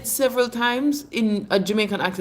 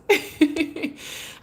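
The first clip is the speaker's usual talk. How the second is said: breathy laugh; 10 semitones higher than usual. Real, not acted. Teasing about names.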